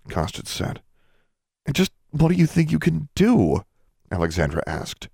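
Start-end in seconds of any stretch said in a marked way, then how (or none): 1.7-3.6 exasperated. A narrator's reading. Alexandra exasperated at friends.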